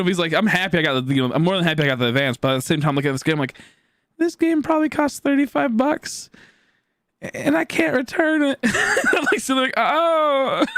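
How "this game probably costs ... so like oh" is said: slow, high-pitched whine breaking into laughter; high, hammed-up letdown; mock dismay. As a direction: squeak out the disappointment, half laughing. Acts dismay, laughing at unwanted game.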